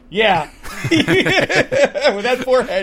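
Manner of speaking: cracking up at the joke; laughing. Real, not acted. Joking about the actor's forehead, laughing hard.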